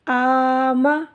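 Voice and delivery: आमा with both long a's stretched out slowly; drawn-out Qur'an reading. Shown as a demonstration. Teacher chanting a Qur'an word for the pupils, slowly. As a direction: chant आमा slowly, holding each vowel. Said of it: "मीम ज़बर मा".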